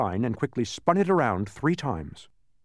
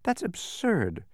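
high, slow protest; incredulous, drawn-out objection; objecting; 12 semitones higher, much slower than usual. A narrator's reading. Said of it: "objected Milo".

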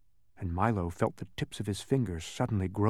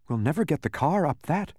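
mildly unhappy, raised-pitch protest; 7 semitones higher than usual. A narrator's reading. Milo facing the rutted mountain path, unhappy. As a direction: object with a touch of dismay. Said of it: "said Milo unhappily".

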